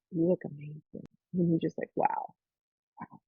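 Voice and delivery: softly imitated amazement; quietly retold wonder; hushed awe. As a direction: drop to a soft, awestruck murmur. Retelling dad's awed reaction.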